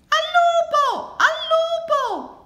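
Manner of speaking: very high, loud, drawn-out shout; piercing, stretched alarm call; cry of wolf. A narrator's reading. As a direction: yell desperately for the village to hear. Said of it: "gridava Luigi".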